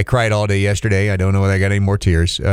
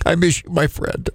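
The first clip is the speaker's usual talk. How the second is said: choked up; 8 semitones higher, 4 dB quieter than usual. Real, not acted. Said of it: "hearing me crying on these".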